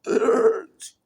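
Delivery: a sudden very high, loud, hammed-up cry of pain; mock anguished wail. Mocking the gossip that torments him, high loud mock wail. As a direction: wail it like a melodramatic martyr. Repeats complaint, rising to a wail.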